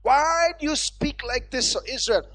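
emphatic, stretched pulpit delivery; forceful, swelling sermon cadence; rebuking preaching. Real, not acted. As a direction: scold the doubters, then proclaim the promise.

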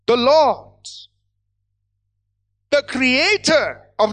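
measured, emphatic scripture declaration; weighty, forceful pulpit reading; preaching proclamation. Real, not acted. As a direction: proclaim each title with long pauses between.

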